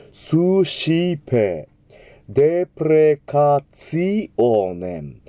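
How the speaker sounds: スシペ with a clear シ, slow; シ not ス. Shown as a demonstration. Teacher correcting the sci sound. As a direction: say スシペ with シ, drawn out. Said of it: "死ではなくて死ですから".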